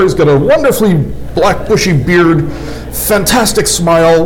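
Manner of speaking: usual speaking voice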